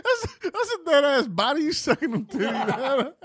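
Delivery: laughing high, through the words. Real, not acted. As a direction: crack up while talking. Cracking up at joke.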